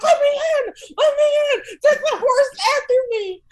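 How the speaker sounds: high, frantic yelling to be let in; panicked pleading. Retold or imitated. Teen banging on her friend's door, high panicked shout. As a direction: shriek it like a terrified teen.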